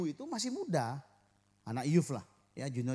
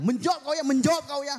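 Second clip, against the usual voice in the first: loud, high angry scold; scolding Batak mother; 9 semitones higher, 7 dB louder, faster than usual. Retold or imitated. Batak mother yelling at her daughter from outside, furious scold. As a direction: snap at the daughter like a furious mum. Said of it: "Terus mamanya bilang".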